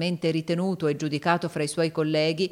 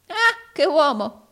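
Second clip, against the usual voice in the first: high, loud, drawn-out croak; croaking exclamation; 11 semitones higher, 8 dB louder, slower than usual. A narrator's reading. Arturo admiring Rodolfo's nerve, croaking exclamation. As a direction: croak it out slowly, pitched up. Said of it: "crocidò Arturo".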